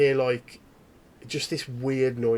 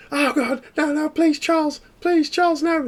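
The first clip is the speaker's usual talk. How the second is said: high-pitched, desperate begging, louder; terrified pleading woman; 6 dB louder than usual. Acted. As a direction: beg for your life, voice up high. Woman pleading while being tortured.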